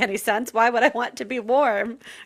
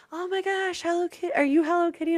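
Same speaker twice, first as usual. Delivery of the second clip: put-on high baby-talk voice; 9 semitones higher than usual. Strangers talking as to a child.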